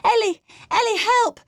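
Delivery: high, loud cries for help; desperate shouting. A narrator's reading. Girl fighting off attackers shouts for help, desperate shouting. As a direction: shout urgently, pitch right up. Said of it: "pausing only to shout".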